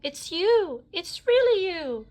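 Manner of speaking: high, loud thrilled shout; excited shout. A narrator's reading. Cowgirl meeting Woody, high loud excited shout. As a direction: shout with delight at the sight of him. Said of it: "shouted the cowgirl".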